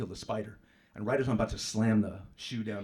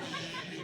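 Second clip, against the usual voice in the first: shushing; 6 dB quieter than usual. Retold or imitated. Rolling away in a wheelchair.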